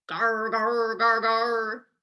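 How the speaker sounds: loud rumbling gar gar of a gourd rolling; rolling gourd sound. A narrator's reading. Narrator voicing the rolling gourd, loud rumble.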